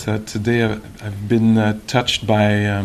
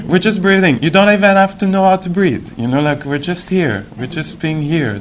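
high, loud, playful teacher imitation; mimicking a teacher, pitched well up; imitating meditation teacher; 8 semitones higher, 4 dB louder than usual. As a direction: keep it simple in a raised, amused voice. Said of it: "she was giving instruction for meditation".

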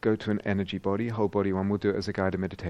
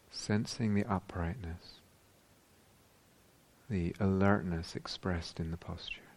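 unhurried meditation voice with long pauses; guided meditation; slower than usual. Real, not acted. Teacher guiding meditators into whole-body awareness, calm and spacious. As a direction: guide them calmly, leaving space after each phrase.

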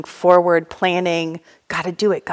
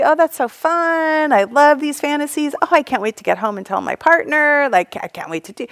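mock delight; 6 semitones higher, 4 dB louder than usual. Mocking her fantasizing mind.

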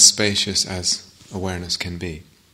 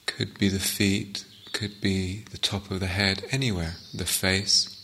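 guided meditation. Acted. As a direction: ease them into the sitting, unhurried.

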